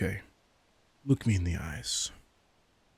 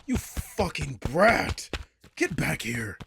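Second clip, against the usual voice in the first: much higher and louder, yelling after them; furious shout; 8 semitones higher, 5 dB louder, slower than usual. Acted. Vampire yelling at her fleeing feral familiar, furious. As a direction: scream it as they bolt.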